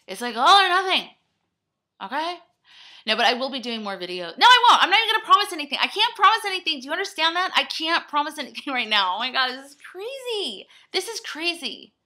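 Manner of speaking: ranting half-laughing at herself; frantic rant. Real, not acted.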